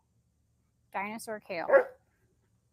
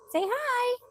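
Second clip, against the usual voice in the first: loud, high pet-talk voice; high coaxing; 8 dB louder than usual. Real, not acted. Coaxing chickens for camera.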